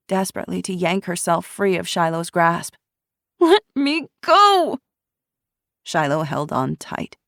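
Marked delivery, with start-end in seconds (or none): 3.4-4.8 high, loud, slow struggling yell; frantic. A narrator's reading. Ruby struggling against Shiloh, frantic yell. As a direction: tear free, shouting.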